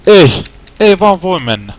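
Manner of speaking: loud, high cry of protest; real gamer rage yell; frustrated yell. Real, not acted. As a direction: shout your disbelief at the screen. Frustrated gamer yelling at game.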